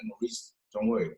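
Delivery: soft, low reassurance; calm him down gently; reassuring. Retold or imitated. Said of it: "I had to assure him".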